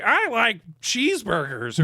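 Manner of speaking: high goofy dog voice for the pet; dopey dog voice. Retold or imitated. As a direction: play the dog as a lovable dimwit. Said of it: "she was like".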